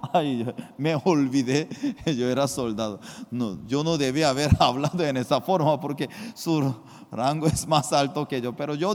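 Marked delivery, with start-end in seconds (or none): none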